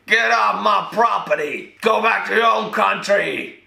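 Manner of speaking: high, drawn-out mock yell; imitated Texan yelling. Texans yelling at invaders to leave, mock outrage. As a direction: holler like an outraged Texan in parody. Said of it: "Imagine four thousand Texans yelling".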